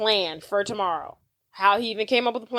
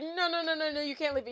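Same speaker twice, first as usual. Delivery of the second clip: higher, softer hammed-up pleading; mock freaking out; 6 semitones higher, 9 dB quieter, faster than usual. Host retelling Frank begging not to be left, mock freak-out. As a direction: plead in pretend panic, voice up and small. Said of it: "Frank starts freaking out".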